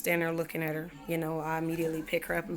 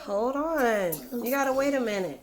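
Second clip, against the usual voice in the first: higher and louder, firm but kind; 7 semitones higher, 6 dB louder than usual. Real, not acted. Mother telling interrupting child to wait, raised and firm. Mom stops to answer her child.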